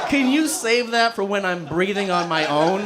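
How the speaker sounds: high, aggrieved comic plea; mock indignant protest. Patient on breathing tube to dad, mock indignant protest.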